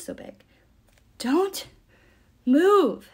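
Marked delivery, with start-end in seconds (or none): 1.2-3.1 loud, high words broken by gasping pauses; panting. A narrator's reading. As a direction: force out each word, out of breath. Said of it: "panted the creature".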